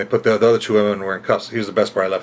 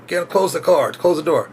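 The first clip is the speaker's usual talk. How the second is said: high, commanding stay in the car; mock cop yell; 6 semitones higher than usual. Police ordering the woman back in, mock yell. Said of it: "just yell at her".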